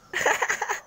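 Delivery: high burst of laughter; real high-pitched giggle. Real, not acted. Laughs after asking about crying.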